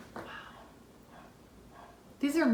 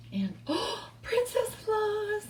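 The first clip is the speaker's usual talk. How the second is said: hyperventilating; 8 semitones higher, 5 dB quieter, slower than usual. Real, not acted. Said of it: "I'm hyperventilating".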